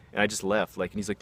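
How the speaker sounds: ordinary delivery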